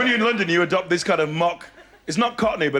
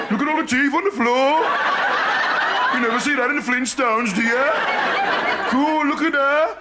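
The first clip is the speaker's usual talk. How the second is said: put-on higher, excited kid voice; imitated boy, thrilled and pitched up; gawping young friend; 6 semitones higher than usual. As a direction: marvel like a lad watching a cartoon. Acting friend gawping at injury.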